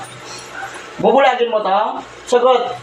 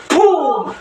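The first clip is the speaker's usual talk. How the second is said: a sharp puff of breath blown out; blowing puff; 7 semitones higher than usual. Real, not acted. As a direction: blow a quick burst of air as if casting out a spirit. Healer blowing on the patient.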